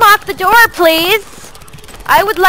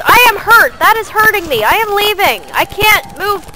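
usual speaking voice

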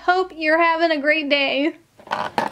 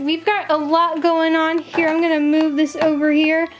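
normal talking voice

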